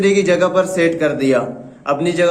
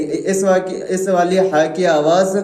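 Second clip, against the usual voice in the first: normal talking voice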